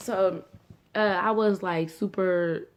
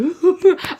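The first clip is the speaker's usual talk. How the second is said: sudden loud high laugh; high burst of laughter; 13 semitones higher, 11 dB louder than usual. Real, not acted. Laughing at Jordan sitting there happy, loud high laugh.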